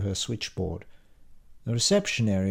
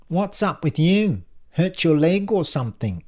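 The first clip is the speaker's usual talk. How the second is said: higher, nosy receptionist voice, "something" ending in "ink"; high voice with a hard k on "something"; Lucy; 8 semitones higher, 4 dB louder than usual. A narrator's reading. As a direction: ask bluntly, saying "something" as "somethink". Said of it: "always pronounces her ing words as ink".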